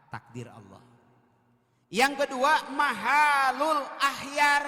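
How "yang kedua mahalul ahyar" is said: high, loud, drawn-out call; preaching shout. Real, not acted. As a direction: lift the voice and stretch it out to the congregation. Preacher calls out a point. (seruan ceramah yang keras dan panjang)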